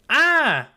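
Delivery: high, loud happy exclamation; Avery's bright greeting yelp; greeting cry. Acted. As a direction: call out in glad surprise. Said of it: "Avery greeted her".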